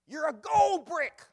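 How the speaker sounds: put-on huge high shout in a thick Hungarian accent; drawn-out mock-furious accented yell. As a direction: bellow it slowly as an angry Hungarian boss. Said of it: "he starts yelling at me with this thick accent".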